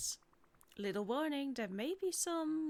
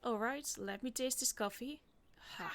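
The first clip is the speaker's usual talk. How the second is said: ordinary talk, nothing marked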